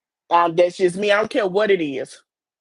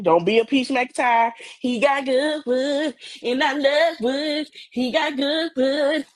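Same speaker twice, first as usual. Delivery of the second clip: higher, slow, dreamy gushing about her man; mock gushing girlfriend; 4 semitones higher, slower than usual. Advising young women, imitating a gushing girlfriend. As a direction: swoon over him in a put-on girly voice. Mocking a woman's gushing praise.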